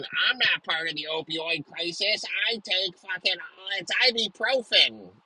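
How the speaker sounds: rising into a high defensive whine; mocking voice. Mocking a social media user who denies being hooked, whiny. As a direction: play the prissy user insisting it's just ibuprofen.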